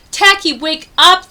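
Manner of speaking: crying out to wake him. Acted. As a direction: rouse a sleeping friend in a panic. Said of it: "they repeated".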